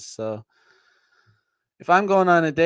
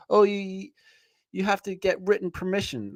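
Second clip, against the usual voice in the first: mocking society; 6 semitones higher than usual. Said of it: "we're all told by society".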